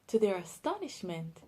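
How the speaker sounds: ordinary delivery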